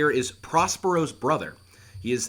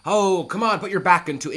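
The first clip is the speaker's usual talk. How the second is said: urging shout; 6 semitones higher, 7 dB louder than usual. Acted. Urging sailors.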